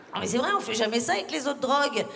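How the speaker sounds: ordinary delivery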